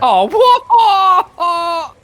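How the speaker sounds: loud, high, laughing shock; startled yelp. Real, not acted. Player startled by something in the game, a loud high laughing yelp. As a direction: jump at the sudden scare.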